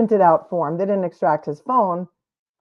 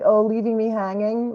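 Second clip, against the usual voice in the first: lightly voiced sulky young teen; mock whiny teen. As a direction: imitate a left-hanging adolescent, lightly. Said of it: "like he's twelve or thirteen or fourteen".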